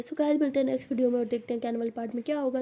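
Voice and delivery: ordinary delivery, nothing marked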